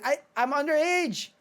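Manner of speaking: high-pitched, stammered comeback; mock protest. Acted protest in retold scolding.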